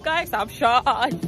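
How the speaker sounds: normal talking voice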